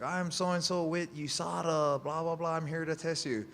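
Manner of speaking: high, put-on official introduction; imitated lady tester. Tester introducing herself at the door, high imitated voice. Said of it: "She's like".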